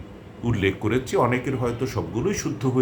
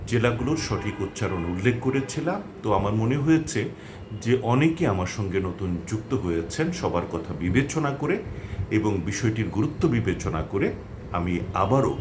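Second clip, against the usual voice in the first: usual speaking voice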